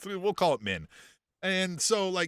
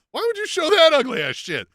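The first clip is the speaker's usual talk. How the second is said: loud, high indignant outburst; a mock-disgusted yell at the screen; mock outrage; 11 semitones higher, 7 dB louder than usual. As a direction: blurt it out, appalled. Mock outraged quote of himself.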